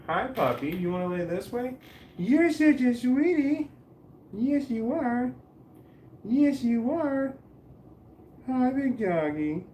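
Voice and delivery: very high-pitched, drawn-out cooing. Real, not acted. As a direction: gush sweetly to a puppy in a squeaky-high voice. Cooing to the dog mid-stream.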